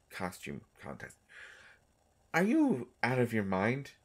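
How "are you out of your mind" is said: louder, higher mock disbelief; mock outrage. Told of the costume contest, mock outraged disbelief. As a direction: play up the shock.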